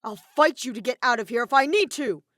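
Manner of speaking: loud shouted threat. A narrator's reading. Kaito threatens to fight his way out, shouting. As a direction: yell the challenge.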